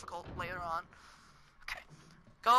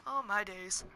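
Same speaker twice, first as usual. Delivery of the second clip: ordinary delivery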